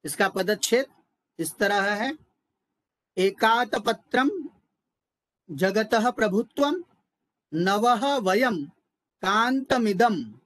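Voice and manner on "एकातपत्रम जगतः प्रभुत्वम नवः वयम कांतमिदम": slow, measured Sanskrit recitation; word-by-word breakdown. Shown as a demonstration. Teacher splitting the verse for students, slow recitation. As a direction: read each word out slowly and separately. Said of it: "पदच्छेद के माध्यम से देखेंगे".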